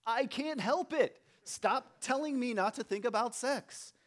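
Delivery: high, slow whiny protest; exasperated kid voice. Retold or imitated. Teen boy begging the nagging voice to stop, slow and whiny. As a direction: whine it out like a frustrated teen boy. Mock frustrated kid's reply.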